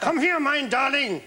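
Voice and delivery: slow hammed-up German accent; lightly put-on German accent, coaxing; Hitler's mock German accent, slow. Shown as a demonstration. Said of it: "But a German accent".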